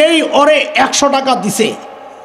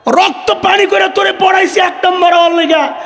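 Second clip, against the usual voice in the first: furious shout from a higher voice; enraged father; 5 semitones higher than usual. Retold or imitated. Foolish father berates his son over the result, furious shout. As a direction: yell at the boy for ranking first.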